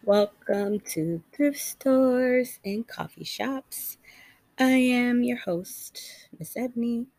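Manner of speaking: slow, drawn-out welcome; podcast host intro. Acted. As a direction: announce the show warmly.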